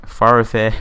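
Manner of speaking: usual speaking voice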